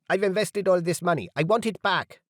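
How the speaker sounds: yelling investor. A narrator's reading. Investor yelling at the delegate, a mild mock yell. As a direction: yell out about lost money. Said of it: "A man nearby yelled".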